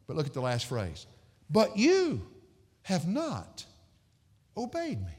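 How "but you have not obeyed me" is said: high-pitched, halting rebuke; measured phrases with heavy pauses; solemn reproach. Acted. Voicing God's reproach from scripture.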